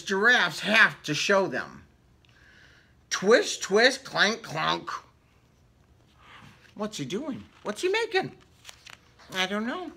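ordinary delivery

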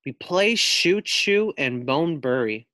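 usual speaking voice

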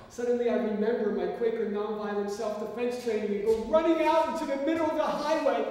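usual speaking voice

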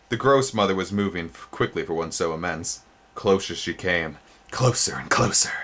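plain reading voice

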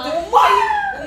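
laughing. Real, not acted. Laughing, voice jumps high.

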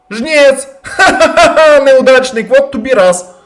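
high, loud, gleeful jeering with a slow, exaggerated cackle; mocking triumphant laugh. Real, not acted. Gamer jeering at the defeated Reaper, gleeful mocking cackle. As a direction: crow over the beaten monster, laughing in its face.

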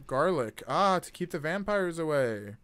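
ordinary talk

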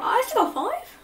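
a high, disbelieving I still got five?; can't believe the score; surprised. Real, not acted.

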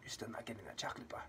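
fast, hushed whisper to the camera; mock-menacing quick whisper; whispered taunt. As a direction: whisper it quickly, as a secret jab. Taunting aside to the absent customer.

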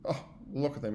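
usual speaking voice

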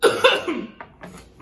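sharp spicy cough; coughing fit. Real, not acted. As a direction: cough from the burn.

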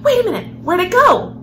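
plain reading voice

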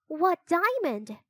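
high, slow innocent question; feigned innocence. Acted. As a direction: play dumb, pitched high and drawn out. Annie feigns ignorance to the king.

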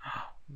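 unvoiced startled gasp; a quick frightened gasp; sharp gasp. Real, not acted. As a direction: catch your breath in shock. Startled by game event.

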